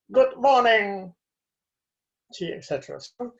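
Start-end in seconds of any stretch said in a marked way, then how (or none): 0.1-1.1 louder, higher, slow greeting; queen's greeting in retelling. Retold or imitated. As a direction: act out a grand, drawn-out greeting. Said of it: "and she said".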